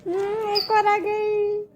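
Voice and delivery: high, slow cooing at the birds; sweet sing-song voice for a pet bird. Real, not acted.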